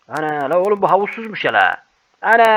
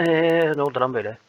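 ordinary talk, nothing marked

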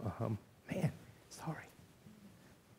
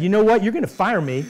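defiant outburst; 9 semitones higher, 10 dB louder than usual. Retold or imitated. Retelling quitting confrontation with boss.

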